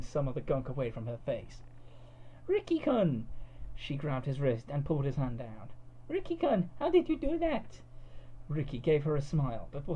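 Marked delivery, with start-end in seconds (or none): 2.5-3.3 high, loud cartoon girl call; high anime girl exclaiming. A narrator's reading. Cud calling out. 6.1-7.8 wide-eyed cartoon girl, pitched way up; cutesy anime girl. A narrator's reading. Cud voiced in story.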